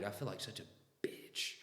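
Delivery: embarrassed hushed whisper. Real, not acted. Owning up to nearly crying, an embarrassed whisper. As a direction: confess it under your breath.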